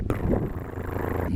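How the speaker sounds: low rumbling engine noise; exhaust rumble. Shown as a demonstration. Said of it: "like that".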